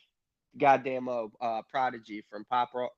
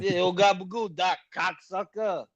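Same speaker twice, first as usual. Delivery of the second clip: high, slow New York Italian voice; Queens accent; 7 semitones higher than usual. Pretend. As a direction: ham up the Jersey-Queens tough guy. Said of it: "a Queens accent".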